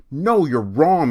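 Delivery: put-on high, loud, snippy kid contradicting; loud whiny brat; defiant bratty girl. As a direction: talk back to the teacher like a sulky girl. Kathy contradicts the teacher.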